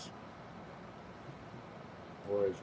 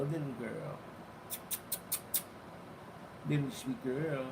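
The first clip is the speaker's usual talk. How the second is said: cooing to a cat; 2 semitones higher than usual. Real, not acted. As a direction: coo gently at the kitty.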